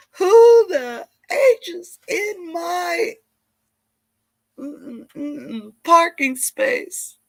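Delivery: high, loud, slow hammed-up yelling; loud mock tantrum with a bleeped curse; mock furious rant. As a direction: play an outraged star losing it. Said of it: "She lost her mind".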